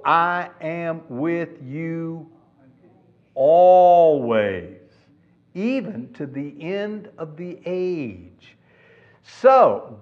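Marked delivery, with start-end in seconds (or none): 0.0-8.6 slow, drawn-out words with pauses; solemn promise. Retold or imitated. Preacher quotes Jesus's promise to the disciples, solemn and slow. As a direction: stretch the promise out for weight.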